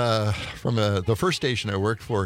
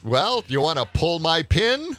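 slow, raised-pitch teasing setup; lightly put-on radio character, drawing out the question; radio persona catchphrase; 6 semitones higher than usual. DJ replays his catchphrase to teenage callers, lightly put-on teasing.

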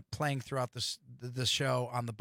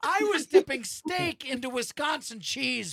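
laughing; 13 semitones higher, 5 dB louder than usual. Real, not acted. Laughing denial, rising pitch.

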